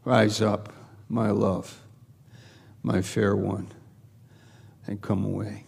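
soft, low, hushed reading; tender. Retold or imitated. God to the beloved, calling them away, tender. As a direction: speak it gently, almost sinking away. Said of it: "It's so tender, so precious".

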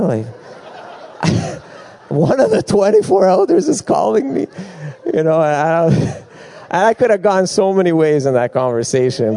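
can't believe it, laugh it out; laughing disbelief. Real, not acted. Laughing over absurd phone call.

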